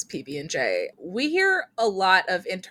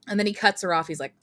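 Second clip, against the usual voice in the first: ordinary delivery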